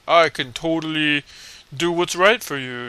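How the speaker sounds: loud, slow, overdone earnestness; mock earnest kid. Kids being way too adult, mock earnest declaration. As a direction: draw it out with mock-noble emphasis.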